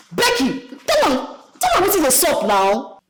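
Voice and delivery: a loud call to Becky to speak her mind; loud egging on. Acted. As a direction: push her to tell it straight. Egging friend on in quarrel.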